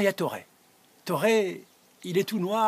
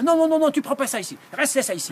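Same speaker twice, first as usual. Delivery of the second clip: refusing; 10 semitones higher, 5 dB louder, faster than usual. Acted. Gérard refusing, rapid.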